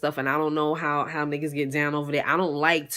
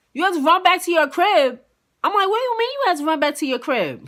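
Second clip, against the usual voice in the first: high, loud disbelieving echo; incredulous; 11 semitones higher, 5 dB louder than usual. Retold or imitated. Girlfriend repeats her date's lame excuse, incredulous. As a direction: throw his words back at him.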